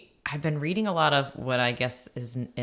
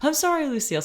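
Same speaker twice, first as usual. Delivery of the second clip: sing-song apology; 4 dB louder, faster than usual. Retold or imitated. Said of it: "I'm like".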